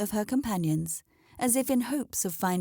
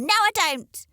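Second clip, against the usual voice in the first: high, loud flat no; loud refusal; 12 semitones higher, 7 dB louder than usual. Acted. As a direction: slam the refusal down. Hen refuses firmly.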